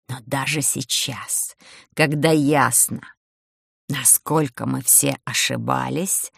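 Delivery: a whispery voice from beyond; eldritch horror. Acted. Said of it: "всё тем же шелестящим потусторонним голосом".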